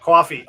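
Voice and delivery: "Coffee" said as kwoffee, rounded aw; Long Island coffee. Shown as a demonstration. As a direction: give "Coffee" the Long Island kwaw sound. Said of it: "Is it like coffee?".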